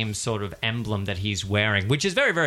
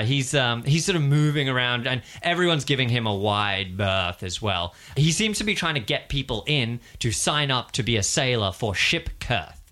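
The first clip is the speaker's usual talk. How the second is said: normal talking voice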